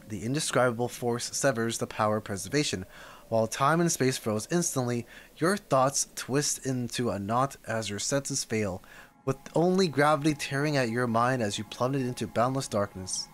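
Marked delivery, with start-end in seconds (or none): none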